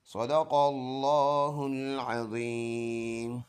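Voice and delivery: chanted closing formula; recitation close. Shown as a demonstration. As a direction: end the recitation in a melodic tune. (तिलावत के अंदाज़ में समापन)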